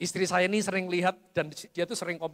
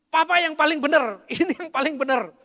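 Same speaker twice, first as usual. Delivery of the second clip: raised, insistent and a little hammed-up; mock stubborn insistence; 8 semitones higher, 6 dB louder than usual. Father to his son, insisting loudly that he's right. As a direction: play the proud father who won't back down.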